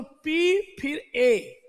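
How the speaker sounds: letters drawn out one by one; slow spelling out. Shown as a demonstration. Teacher spelling slowly for students, drawn out. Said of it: "अब इसको समझो".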